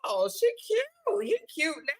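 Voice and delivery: high-pitched retold cooing over a cute couple; retold admiring reaction. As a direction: act out being taken by how cute they are, high. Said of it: "and I was like".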